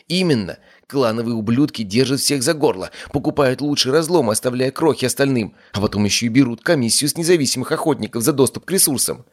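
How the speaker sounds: heated rant. Acted. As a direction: rail against the clans. Heated rant about the clans.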